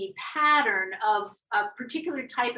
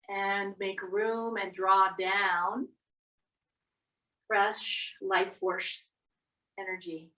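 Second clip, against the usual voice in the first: guided meditation; 2 semitones lower, 5 dB quieter than usual. Acted. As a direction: ease them down gently. Slow soft guided cues.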